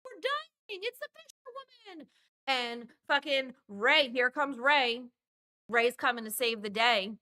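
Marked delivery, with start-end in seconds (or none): 0.0-2.1 high, soft, hammed-up cry for help; mock scared shriek. [name] calling from the boat deck, high mock fear. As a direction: squeak it like a helpless horror heroine.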